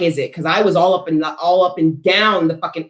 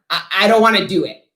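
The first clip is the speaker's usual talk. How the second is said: ordinary talk, nothing marked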